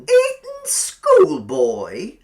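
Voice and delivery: slow, high, loud drawn-out cooing; sweet landlady. A narrator's reading. Landlady echoing Billy, slow high sugary drawl. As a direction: stretch it out with creepy sweetness.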